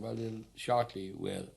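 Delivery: ordinary delivery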